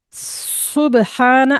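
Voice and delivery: سبحانہ slow, the س hissed clearly; tajweed recitation. Shown as a demonstration. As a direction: recite سبحانہ slowly with a sharp whistling س. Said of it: "اب جیسے بولنا ہے سبحانہ". (سبحانہ آہستہ اور صاف سیٹی والے س کے ساتھ)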